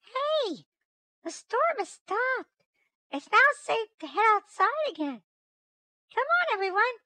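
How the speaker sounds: very high-pitched glad exclamation; delighted Valerie. Acted. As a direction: call out happily in a squeaky voice. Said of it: "exclaimed in delight".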